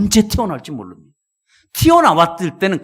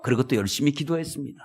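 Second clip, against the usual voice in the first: usual speaking voice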